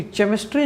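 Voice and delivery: 'केमिस्ट्री' said 'che-mis-tree'; 'केमिस्ट्री' with ch in place of k; wrong ch sound. Shown as a demonstration. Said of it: "नहीं होता".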